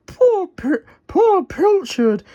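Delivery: slow, high, pitying Wendy; Wendy's sympathy. Acted. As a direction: sigh it out in sympathy. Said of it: "agreed Wendy".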